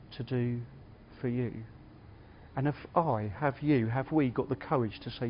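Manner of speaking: soft, measured sermon close with long pauses; reflective preaching. Real, not acted. Preacher asking listeners what they want from Jesus, softly reflective.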